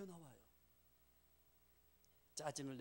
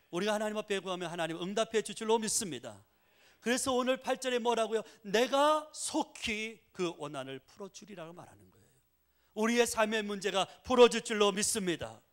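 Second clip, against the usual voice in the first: loud, high revival preaching; fiery preaching; 8 semitones higher, 13 dB louder than usual. Acted. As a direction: proclaim it with full force to the church. Preaching at full force.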